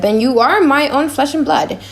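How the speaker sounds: ordinary talk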